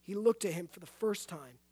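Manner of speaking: ordinary talk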